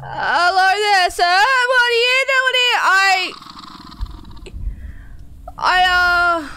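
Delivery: high, drawn-out nervous politeness; uneasy, high-pitched hello; nervous greeting. Real, not acted. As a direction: greet the creepy stranger in a high, strained voice. Nervously greeting a creepy stranger.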